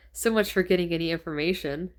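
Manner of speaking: usual speaking voice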